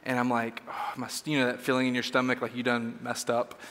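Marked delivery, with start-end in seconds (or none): 0.7-1.2 breathless whisper of dismay; a gasped, unvoiced oh my. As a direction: swallow the curse in a hushed whisper. Sudden dread at ruined decorations.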